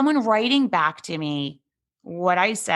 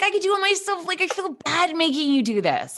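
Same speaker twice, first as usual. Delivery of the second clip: much higher apologetic voice; sheepish guest; 11 semitones higher than usual. Retold or imitated. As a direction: apologise to the omelet cook. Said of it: "Sometimes I wanna be like".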